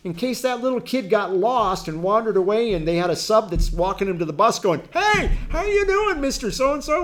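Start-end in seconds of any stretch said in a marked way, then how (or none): 4.9-7.0 put-on high, slow, sing-song grown-up greeting to a child; a sub breezily greeting a passing adult; chatty substitute teacher. Sub walking kids to the bus, high cheery greeting. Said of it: "And we're talking like this".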